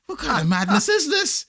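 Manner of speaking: high, exasperated squeak of disbelief; shrill-sounding disbelief, hammed up; mock outrage. Dad exasperated at son vanishing.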